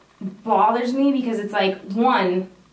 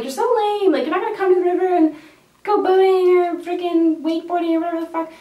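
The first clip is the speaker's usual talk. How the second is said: high, fast whiny taunt; mocking friends; 9 semitones higher, 4 dB louder than usual. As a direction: tease her like a pushy friend. Friends mocking her for refusing.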